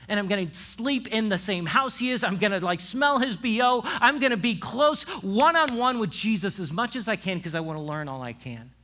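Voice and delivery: fired-up disciple, loud and high; eager follower. Acted. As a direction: gush with all-in devotion. Acts Peter eagerly following Jesus.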